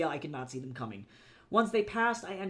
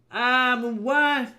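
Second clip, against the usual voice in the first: foreign curse, loud and high; cursing; 6 semitones higher, 9 dB louder than usual. Acted. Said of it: "someone cursed in a language".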